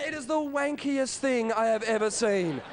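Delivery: normal talking voice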